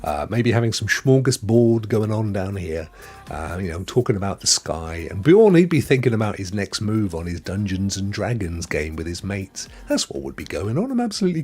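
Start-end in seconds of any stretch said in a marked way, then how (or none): none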